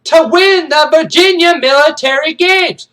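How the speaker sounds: drill-sergeant bellow, high and loud; hammered parade-ground declaration; Major Payne. Acted. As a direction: shout it to the whole column. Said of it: "He's like".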